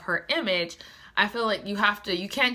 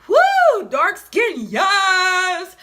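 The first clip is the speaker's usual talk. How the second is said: high, loud whoop of delight; excited cheer; 10 semitones higher, 8 dB louder, much slower than usual. Retold or imitated. Reader recalling her cheer for a dark-skinned heroine, loud excited whoop. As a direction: cheer it like the book just made your day.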